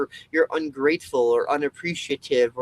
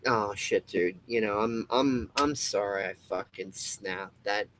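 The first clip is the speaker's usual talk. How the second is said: 1 sheepish apology; 5 semitones lower, 4 dB quieter than usual. Retold or imitated. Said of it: "You're probably gonna be like".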